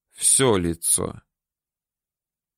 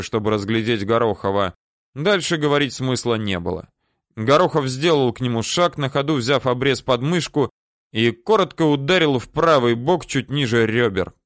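ordinary delivery, nothing marked

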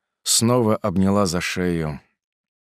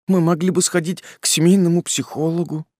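tearful suggestion; 10 semitones higher than usual. Acted. Polina suggests therapy, pleading.

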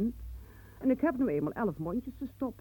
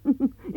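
bitter laugh; 6 semitones higher, 6 dB louder than usual. Acted. Bitter laugh mid-quarrel.

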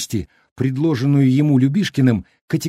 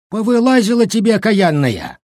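put-on high-pitched scolding old man; lashing out; 9 semitones higher, 4 dB louder than usual. A narrator's reading. Old cook turning on the village woman, scolding. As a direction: lay into her indignantly. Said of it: "обрушился на бабу дед Щукарь".

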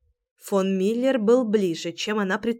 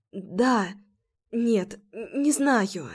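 quiet, slow, lost in confusion; confused hesitant; 3 dB quieter, much slower than usual. A narrator's reading. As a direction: falter over each word. Confused frightened girl answers.

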